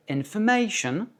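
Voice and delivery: information with a long, accented MA; English "information", MAY stressed before a light -shun. Shown as a demonstration. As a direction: hit the A in inforMAtion. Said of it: "le A est accentué".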